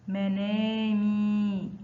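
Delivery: منامي very slowly, مي held long; drawn-out example word. Shown as a demonstration. Teacher showing pupils the long vowel, slow and stretched.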